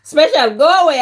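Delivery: mock-annoyed brush-off; mock shoo. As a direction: shoo the viewer off, half-joking. Shooing a teasing viewer.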